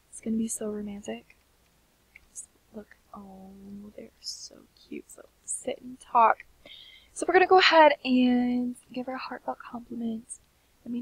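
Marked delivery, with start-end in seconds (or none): none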